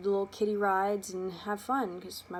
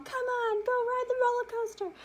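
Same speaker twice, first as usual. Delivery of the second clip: high, urging mom voice; 4 dB louder than usual. Retold or imitated. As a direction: nudge the child along. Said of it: "And my mom".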